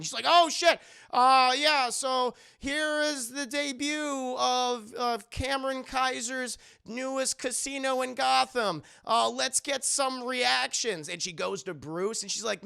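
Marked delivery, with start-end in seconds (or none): 0.3-11.1 higher, stretched-out on-air presenter voice; startle, then slide into TV reporting; imitated reporter caught on air; flustered live reporter. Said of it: "you're live".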